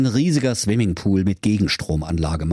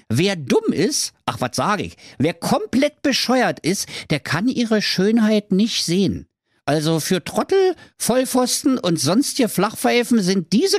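Höke's sales pitch; 11 semitones higher than usual. Acted. Höke erklärt Kleider.

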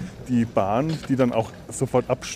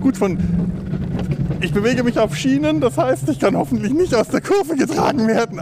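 excited; 10 semitones higher than usual. Real, not acted. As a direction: talk fast with thrill. Talking while speeding down.

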